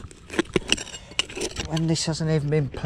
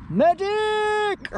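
man down shout. Pretend. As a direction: yell for a medic under fire. Mock wounded soldier, calling again.

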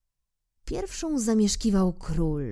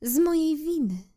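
higher, proud flower voice, hiding her hurt; a vain rose saying goodbye, voice pitched higher; 7 semitones higher than usual. Acted. Rose bids little prince farewell, proud and higher.

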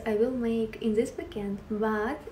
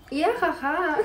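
laughing; 5 semitones higher than usual. Real, not acted. Laughing at a viewer comment.

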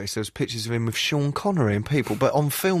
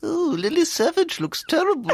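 mock Queen. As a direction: sniff it like the disapproving Queen. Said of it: "I think she'll go".

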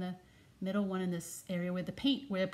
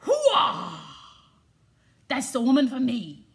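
Al Pacino hooah; 2 semitones higher, 6 dB louder than usual. Retold or imitated. Grandpa spotting his future wife, loud mock Pacino. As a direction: bark it like a fired-up movie tough guy. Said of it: "I bet he did a Pacino move and went".

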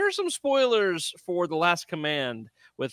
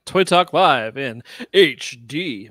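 slow, louder announcer echo; 5 dB louder, slower than usual. Acted. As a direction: boom out the segment title. Echoing segment name louder.

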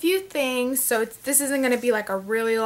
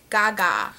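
ordinary talk

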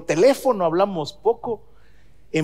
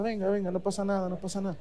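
soothing; 9 dB quieter than usual. Retold or imitated. Father comforting crying grandson.

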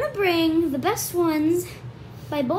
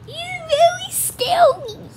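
slow, very high, louder voice; squealed mock fear; 5 dB louder than usual. Kid reacts to Dad's Gollum, high squealed mock fright. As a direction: squeak it out slowly, overdoing the fright.

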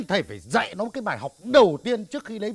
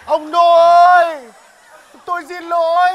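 mock calling out; 4 semitones higher, 10 dB louder, slower than usual. Husband calls after servant mockingly.

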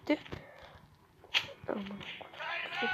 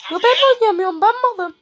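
self-directed rage; 6 semitones higher, 4 dB louder than usual. Real, not acted. Kendine kızıp bağırıyor.

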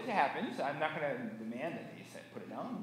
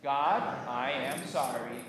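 slow, measured apology in prayer; congregational recitation; 4 semitones lower, 4 dB louder, slower than usual. Shown as a demonstration. Pastor leading congregation's apology to God, slow recitation. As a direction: space the words so all can join. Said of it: "and say it with me".

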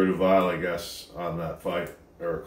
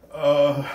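pained grunt; 6 semitones higher, 7 dB louder than usual. Real, not acted. Mouth burning from hot food.

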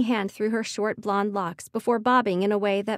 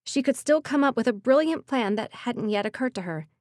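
plain reading voice

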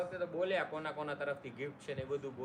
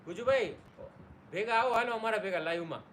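1 calling out; 6 semitones higher, 7 dB louder than usual. Real, not acted. Inviting friend to join live.

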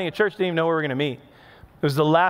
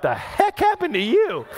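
higher, startled wife asking what happened; wife's shocked question; 9 semitones higher than usual. Retold or imitated. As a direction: play the wife seeing him covered in food, higher and startled. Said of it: "Anna was like".

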